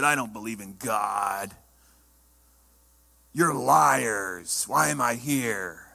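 low, slow, hostile growl of a possessed man; menacing possessed man. Retold or imitated. Possessed man glaring at the pastor, low and slow menace. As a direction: spit it out slowly like a demon-ridden skeptic. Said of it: "he squinted his eyes at me and said".

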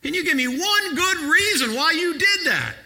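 high, loud, breathless exasperation; exasperated. Retold or imitated. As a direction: gasp it out at the son, shaken. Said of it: "I gasped in, in exasperation".

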